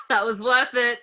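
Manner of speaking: laughing, pleased with the prank. Acted. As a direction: laugh it out, delighted.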